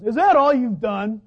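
loud, slow, accusing question; critical boss. Acted. Boss belittling a worker's best effort, loud and critical. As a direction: imitate a boss putting down hard work. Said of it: "your boss comes up and goes".

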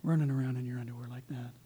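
low, muted acted scolding; disapproving wife. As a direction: deflate the joy with a low reproach. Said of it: "And she goes".

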